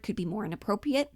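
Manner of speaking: ordinary talk, nothing marked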